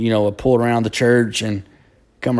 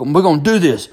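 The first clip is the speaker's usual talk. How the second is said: dad insisting, a touch raised; stern father; 4 semitones higher than usual. Retold or imitated. Dad tells crying son they fight, firm. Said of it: "my daddy walks over and goes".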